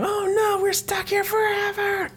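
high hammed-up group scream; mock scream. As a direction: squeal it in pretend panic. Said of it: "And then they all scream".

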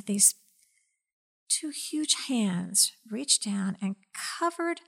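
ordinary delivery

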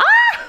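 loud, high-pitched scream breaking into laughter; piercing re-enacted shriek; shocked scream. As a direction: let out a shriek of amused disbelief. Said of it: "så jag skriker rätt ut".

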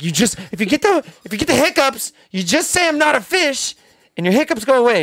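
high, eager retelling of the trick; excited high explanation; excited explaining. Retold or imitated. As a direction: pitch way up like it's big news.

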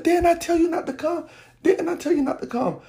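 high-pitched reproach; retold upset scolding. Mother scolding child who followed, retold upset scolding. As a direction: reenact a distressed mother telling off her child.

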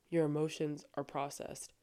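ordinary talk, nothing marked

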